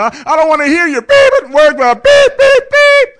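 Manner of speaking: high, loud, fast bleeped rant; mad bleeped rant. Retold or imitated. Angry dad shouting down his family, mad bleeped rant. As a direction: rant the beeps in mock fury. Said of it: "oh, I was mad".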